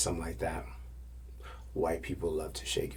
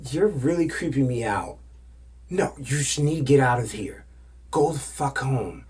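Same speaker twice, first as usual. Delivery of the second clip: imitated annoyed dismissal; 6 semitones higher, 6 dB louder than usual. Woman dismissing the man.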